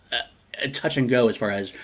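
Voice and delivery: ordinary delivery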